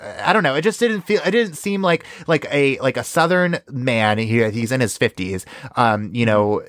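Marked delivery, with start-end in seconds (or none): none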